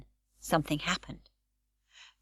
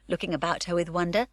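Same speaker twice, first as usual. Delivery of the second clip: ordinary delivery, nothing marked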